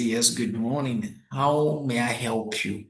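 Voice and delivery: slow, soft, polite phone greeting; calm courteous greeting. Shown as a demonstration. Consultant answering a course enquiry, slow and calm. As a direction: greet the caller slowly and gently. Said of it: "you know how I speak".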